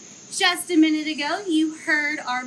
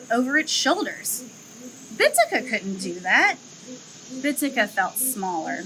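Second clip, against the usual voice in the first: story narration; 3 semitones lower, 4 dB louder than usual. A narrator's reading. Zoo educator reading a lemur story to children, gently storytelling. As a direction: read it like a picture book for kids.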